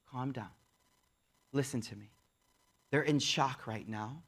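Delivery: very soft, low, calming voice; hushed, steadying wife, retold; soothing. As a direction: settle someone down quietly. Wife soothing her upset husband.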